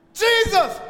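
high, loud call of a name; screaming out. Acted. Disciples calling Jesus from the boat, screaming. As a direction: cry out to him across the lake. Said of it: "they start screaming".

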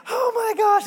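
very high, loud, overwhelmed; stunned child. Retold or imitated. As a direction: squeal it like a shocked little girl. Daughter sees the Barbie Jeep.

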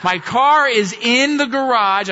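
mock-heroic proud declaration; triumphant. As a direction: savour the win word by word. Triumphant payoff of snow story.

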